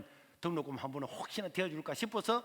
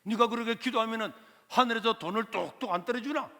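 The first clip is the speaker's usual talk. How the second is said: 높고 큰 소리로 비꼬는 삼촌; 새벽기도를 비웃듯 크게 빈정대기; sarcastic uncle; 8 semitones higher, 8 dB louder than usual. Retold or imitated. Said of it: "비꼬더라고요". (기도하는 조카를 놀리는 삼촌, 높고 크게 비꼬듯)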